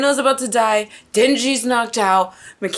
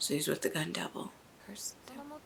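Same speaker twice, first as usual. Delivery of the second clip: fast hushed whisper; 6 semitones lower, 20 dB quieter, much faster than usual. Real, not acted. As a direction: murmur it under your breath. Whispering while watching.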